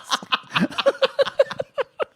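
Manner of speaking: a burst of high, squealing laughter; high shrieking laugh. Real, not acted. Co-host laughing at the sweaty poodle joke, high squealing laugh.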